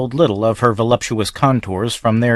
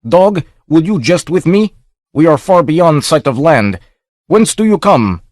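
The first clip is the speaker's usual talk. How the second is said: higher, louder, angry accusing captain; ship's master accusing the swimmer of jesting, angry; 7 semitones higher, 4 dB louder than usual. Acted. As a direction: rage at him for mocking you. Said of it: "exclaimed the master angrily".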